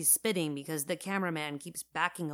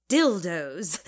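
loud, drawn-out showbiz reveal; grand showy announcement; 7 dB louder than usual. A narrator's reading. Marissa unveils her name idea to the crew, showy. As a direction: present it like a Broadway title. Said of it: "like she's presenting a Broadway play".

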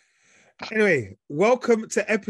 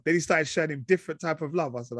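ordinary talk, nothing marked

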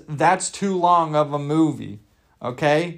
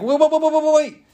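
high, rapid alarmed waits; panicked; 11 semitones higher, 3 dB louder than usual. Pretend. Crowd on the moon warning the astronaut, high alarmed cries. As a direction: voice the crowd panicking as the helmet comes off.

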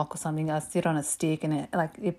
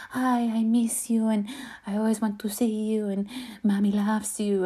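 imitation of a doting mum; sweet mum; 4 semitones higher than usual. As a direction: coo lovingly to your grown child. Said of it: "mum that's very, like, sweet".

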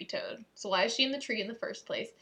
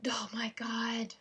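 unvoiced whisper of dismay; 6 dB quieter, slower than usual. Real, not acted. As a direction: whisper it under your breath. Botches it again.

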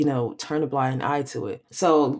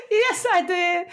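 real laughter, much higher pitched; laughing. Real, not acted. Co-host caught out by her friend, laughing high. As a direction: squeal it through giggles. Caught using a banned word.

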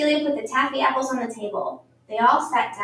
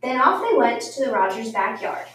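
plain reading voice

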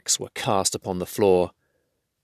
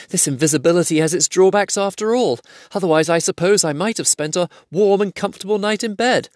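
eager cry; 11 semitones higher than usual. A narrator's reading. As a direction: call out in a rush to be found. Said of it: "he cried".

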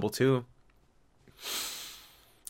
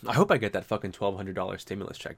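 usual speaking voice

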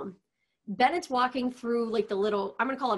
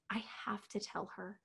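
mock whisper; 13 dB quieter than usual. Retold confession, whispered.

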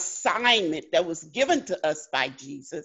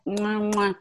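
between kisses. Real, not acted. Saying good night to the group.

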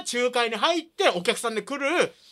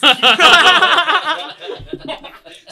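loud burst of laughter; 6 semitones higher, 11 dB louder than usual. Real, not acted. Bursts out after the reveal.

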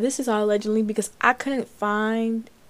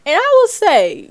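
excited; 8 semitones higher, 11 dB louder than usual. Real, not acted. Hyped up telling gossip.

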